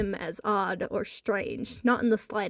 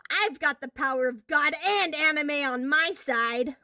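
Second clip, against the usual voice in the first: higher, boastful hero voice; mock bravado; 5 semitones higher than usual. A narrator's reading. Boy boasting to the bully about his powers, mock heroic. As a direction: play the anime hero.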